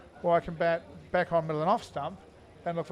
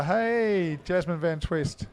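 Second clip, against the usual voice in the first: usual speaking voice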